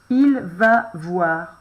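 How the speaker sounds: slow, word by word dictation. Shown as a demonstration. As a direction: dictate each word slowly. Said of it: "On va écrire ça, simplement". (dictée lente, mot à mot)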